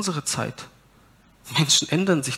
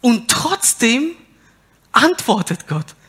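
preaching at full force; 8 semitones higher, 8 dB louder than usual. Real, not acted. Preacher tells the congregation God still answers, loud preaching. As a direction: build the sermon to a loud peak.

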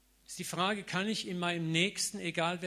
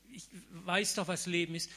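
ordinary talk, nothing marked

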